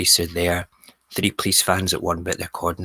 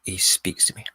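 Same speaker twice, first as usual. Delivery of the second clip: plain reading voice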